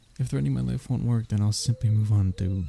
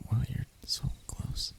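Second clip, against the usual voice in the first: awed whisper, much softer; 7 dB quieter than usual. Acted. Captive whispering as the dragon leans in close. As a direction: breathe it, surprised at closeness.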